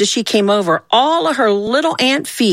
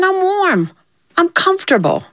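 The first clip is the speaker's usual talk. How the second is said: dismissive refusal; 11 semitones higher than usual. Acted. Hoppy rebuffs offer of shoes.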